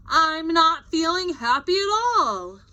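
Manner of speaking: whiny sulk from Kansas; mock glum. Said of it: "close up of Kansas".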